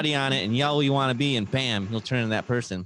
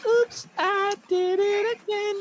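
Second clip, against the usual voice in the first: much higher, slow, breathy pop-star squeak; much slower than usual. Pretend. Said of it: "I want to be like [name]".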